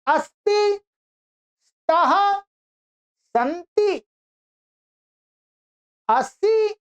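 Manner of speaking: high, careful chant of each verb form; teacher modeling Sanskrit forms; model recitation. Shown as a demonstration. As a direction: say each form clearly for students to copy. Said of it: "बोलिएगा".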